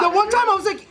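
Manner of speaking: normal talking voice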